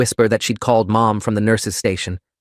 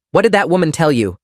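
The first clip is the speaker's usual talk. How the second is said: demanding; 7 semitones higher, 3 dB louder than usual. Acted. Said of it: "Grandma Fiona demanded".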